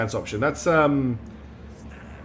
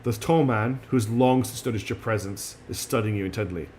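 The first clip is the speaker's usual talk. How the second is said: ordinary talk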